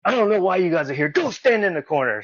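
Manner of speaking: fast, high-pitched scolding order; imitated sergeant. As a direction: act out a drill sergeant dismissing rookies.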